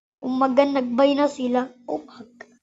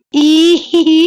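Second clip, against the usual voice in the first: loud mock-giddy "ihihi" giggle; teasing giggle; 3 semitones higher, 8 dB louder than usual. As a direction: squeal a put-on lovestruck giggle. Teasing someone about a crush, joking. (kunwaring kinikilig na hagikgik)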